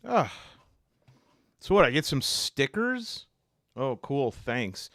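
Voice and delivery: ordinary delivery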